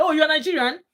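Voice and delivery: high, loud, amazed question; delighted discovery of a fellow Nigerian; surprised, loud rising question. Retold or imitated.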